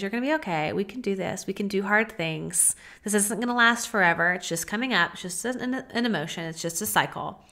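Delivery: soft, comforting reassurance; reassuring self-talk. Pretend. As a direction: promise yourself it will pass. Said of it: "in a way that says".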